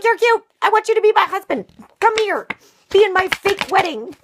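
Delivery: high, loud giddy squeal; a smitten kid begging him over; gushing childish calls; excited little girl. Retold or imitated. Girl chasing boy as kids.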